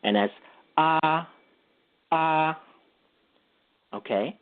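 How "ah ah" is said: ah held on one flat level pitch; first tone, level. Shown as a demonstration. Teaching a listener Mandarin's first tone, held level ah. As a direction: sing a steady straight tone ah. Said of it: "just say the straight tone or the first tone".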